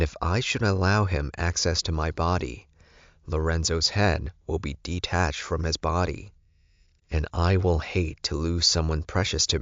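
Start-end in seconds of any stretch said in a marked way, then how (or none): none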